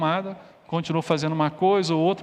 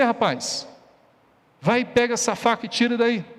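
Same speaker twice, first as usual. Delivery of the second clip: raised, higher-pitched angry scolding; louder, harsher father; 6 semitones higher than usual. Acted. Father scolding a son who won't stop, loud and harsh. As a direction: tell him off forcefully, fed up. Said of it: "falou bem mais alto e bem mais ríspido, forte".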